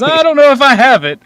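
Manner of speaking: high, loud talking-tree voice; squeaky, surprised tree answering the request. Retold or imitated. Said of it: "And it says".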